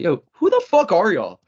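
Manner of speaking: squaring up to the other team; retold challenge. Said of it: "I'm like".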